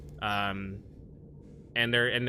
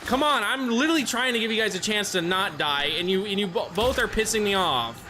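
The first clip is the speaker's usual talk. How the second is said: exasperated; 9 semitones higher, 4 dB louder than usual. Real, not acted. Teammates dying, streamer annoyed.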